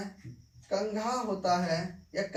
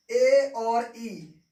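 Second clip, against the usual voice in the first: letters drawn out slowly and loud, E and I stretched; slow loud dictation of letters; slow spelling out; 5 dB louder, much slower than usual. Shown as a demonstration. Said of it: "आप ध्यान से देखिए कि दोनों के साथ में ए और ई".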